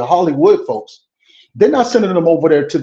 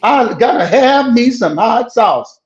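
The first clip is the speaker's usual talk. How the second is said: high, slow put-on voice; mock Hillary Clinton; 8 semitones higher, much slower than usual. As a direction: ham up a politician pandering, high and slow. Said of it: "And Hillary Clinton".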